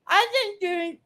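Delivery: normal talking voice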